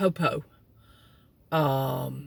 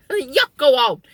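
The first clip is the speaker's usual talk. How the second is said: shooing the cat; 6 semitones higher, 5 dB louder than usual. Retold or imitated.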